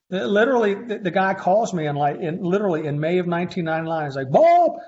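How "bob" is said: high, loud call of a name; hammed-up high-pitched phone greeting; exuberant greeting. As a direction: mimic a caller's booming hello, pitched way up.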